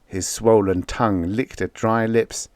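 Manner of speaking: ordinary delivery, nothing marked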